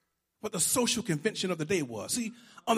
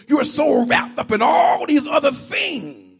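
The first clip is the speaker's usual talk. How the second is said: preaching push; 4 semitones higher, 8 dB louder than usual. Real, not acted. Preaching at full force.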